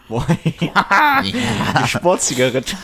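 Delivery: real laughter breaking through the words; high, slow, helpless laugh while talking. Real, not acted. Co-host delights in the sports cigarette joke, laughing. Cracking up at joke sponsor idea.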